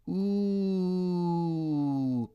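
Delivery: long, high, soft ooh; one sustained note gliding slowly down; crowd's awed "ooh". A narrator's reading. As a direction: marvel like a fireworks crowd. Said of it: "everybody goes".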